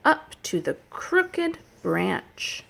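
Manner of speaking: rhythmic, sing-song story chant; reading to children. Acted. Teacher reading a nature rhyme to children, sing-song. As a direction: read it like a picture book for little kids.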